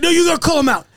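high-pitched mock insistence; mad. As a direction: imitate an upset friend demanding backup. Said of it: "Jeff gets so mad".

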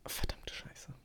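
whispered curse, almost no voice; cursing under breath. Real, not acted. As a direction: swear softly to yourself, unvoiced. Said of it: "bei der ersten Frage hab ich dich schon".

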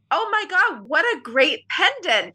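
put-on high, gushing older lady, slowing on the praise; older woman admiring. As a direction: coo over the pendant like a delighted older woman. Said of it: "she goes".

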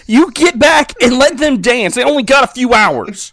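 high, loud outburst of mock outrage; mock indignant rant. Fan telling off the sheriff for cutting in on the dance, indignant. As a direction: scold him hard, loud and high-pitched.

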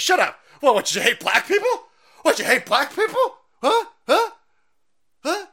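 high, loud mock-indignant yelling; mock outraged shout. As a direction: shriek it like an offended stranger. Mock outraged rant at someone.

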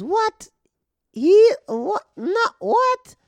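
very high, loud mock shriek of confusion; confused heckler. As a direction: screech it like a baffled drunk lady. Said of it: "And then she goes".

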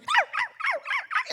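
high, repeated imitation dog yaps; annoying constant barking. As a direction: yap insistently like a hungry little dog. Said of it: "it's this annoying constant".

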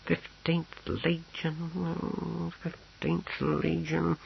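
high, soft, very drawn-out repetition; absent-minded muttering. A narrator's reading. Clerk searches his papers for the legion, absently muttering. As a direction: draw it out slowly while searching. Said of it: "shuffling through the papers".

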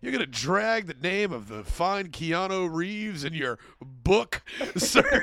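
slow, judgmental mock stepdad; hammed-up reproach breaking into laughter; Keith Morrison. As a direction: drag out the mock disapproval. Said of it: "he just judged him".

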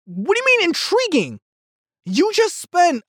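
incredulous disbelief. Real, not acted. Vlogger reacting to Morbius's answer, high and incredulous. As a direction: squeak it out in disbelief. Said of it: "I'm like".

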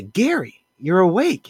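high-pitched astonished exclamation; mock surprise. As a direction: react with amazement, hammed up for a story. Acted surprise at comatose man waking.

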